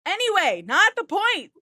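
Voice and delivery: yelling at interrupting friends, exasperated. Real, not acted. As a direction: shout them down to get back on track.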